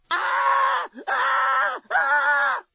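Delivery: shrill repeated screams of panic; screaming uncontrollably. A narrator's reading. Lucy panics at the alien, screaming nonstop. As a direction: let the screams run wild. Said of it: "not about to be calmed down".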